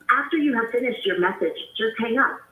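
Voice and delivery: ordinary delivery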